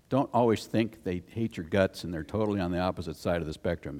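normal talking voice

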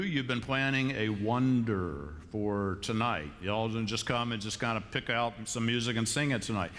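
usual speaking voice

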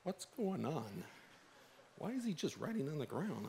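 hushed confusion, as if not wanting to be heard; puzzled crowd murmur. Retold or imitated. Crowd wondering why Jesus writes on the ground, soft puzzled murmur. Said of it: "I can imagine them going".

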